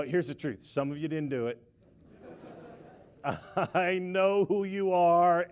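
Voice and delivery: usual speaking voice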